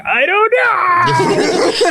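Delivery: very slow, high, dazed whimper; lost and helpless while your hands keep moving; drawn-out, high, frightened confusion; bewildered. Retold or imitated. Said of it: "I'm like".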